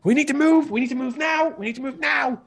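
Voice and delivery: high, loud urgent shout. Acted. Captain shouting at the crew to move now. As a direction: yell it like the ship depends on it. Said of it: "she starts shouting".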